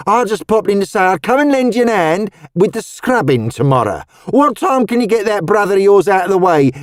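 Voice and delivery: put-on high, lively Cockney old woman; Cockney charwoman. A narrator's reading. Missus Tubbs startled by Roseanne's outfit, high lively Cockney.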